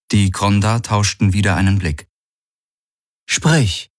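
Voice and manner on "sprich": high, curt command; commanding order. Acted. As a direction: order him to speak. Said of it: "sagte Ylana".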